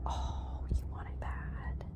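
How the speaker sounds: soft unvoiced whisper. Real, not acted. Whispering teasingly to the horse. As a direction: tease her in a whisper.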